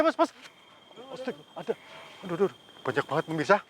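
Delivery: ordinary talk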